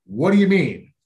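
loud, high, exasperated demand; angry boss. Retold or imitated. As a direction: snap it like a furious boss on the phone. Boss angry about the report call.

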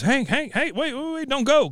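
high, pleading call; begging Peggy. Retold or imitated. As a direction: plead with him not to go. Peggy begging Hank to stay.

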